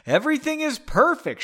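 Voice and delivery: loud, high indignant retort; heated acted quarrel voice; yelling back. A narrator's reading. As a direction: hurl it back, climbing in pitch. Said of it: "she yelled back".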